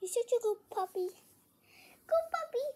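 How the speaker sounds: high-pitched puppy praise; squeaky praising a dog. Real, not acted. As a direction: coo good puppy squeakily.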